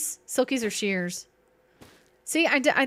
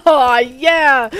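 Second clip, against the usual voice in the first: much louder, gleeful oh yeah; 10 dB louder than usual. Real, not acted. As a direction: whoop it out with glee. Happy reaction in play.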